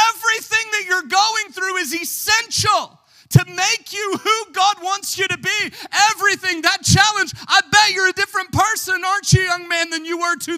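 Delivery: high, loud shouted sermon; fiery revival preaching, slowing on the quotes; preaching at full force. Acted. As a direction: shout it to the back pew.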